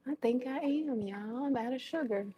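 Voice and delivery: high, soft dismayed disbelief; high soft dismay over missing sugar; dismayed surprise. Real, not acted. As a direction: realize the sugar is gone, softly. Discovers no sugar left.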